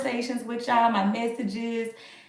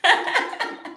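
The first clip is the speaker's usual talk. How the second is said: airy high laugh; a real breathy giggle; 11 semitones higher than usual. Real, not acted. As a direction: laugh at dad's teasing. Laughing at dad's joke.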